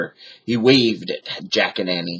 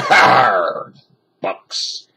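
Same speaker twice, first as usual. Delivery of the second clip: scornful pirate growl fading to a whisper. A narrator's reading. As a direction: growl the word in disgust. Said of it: "growled Captain Bones".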